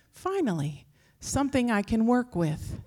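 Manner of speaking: normal talking voice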